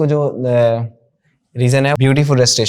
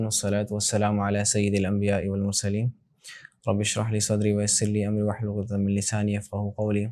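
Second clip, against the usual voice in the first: measured, softer prayerful recitation; Arabic recitation; 4 semitones lower, 9 dB quieter than usual. Acted. Teacher opening class with Quranic recitation, reverent chant.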